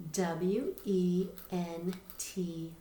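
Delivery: slow, letter by letter; teacher spelling a word aloud. Shown as a demonstration. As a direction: spell each letter slowly and clearly. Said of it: "spelling letter by letter".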